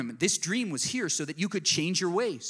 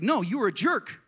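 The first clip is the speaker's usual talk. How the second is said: louder and higher, a sharp rebuke; blunt callout; 7 semitones higher, 6 dB louder than usual. Retold or imitated. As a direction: snap back at the excuse-maker. Blunt retort to excuses.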